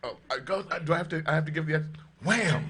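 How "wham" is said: "Wham!" said high with an exclamation; exclaimed. Shown as a demonstration. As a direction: shout "Wham!" with a punch. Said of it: "I'll give it the exclamation".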